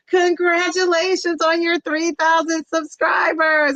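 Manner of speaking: big happy announcement; excited congratulations. Real, not acted. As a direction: shout it across the room with joy. Celebrating a friend's milestone.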